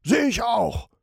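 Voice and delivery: captain shouting. Acted. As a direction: yell it back over the storm. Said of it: "schreit Captain Sharky".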